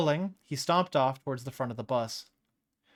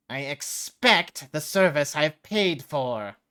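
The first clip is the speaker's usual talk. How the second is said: slow, louder indignant businessman; snooty demand; 3 semitones higher, 7 dB louder, much slower than usual. Acted. The businessman wheeling on the driver, slow offended demand.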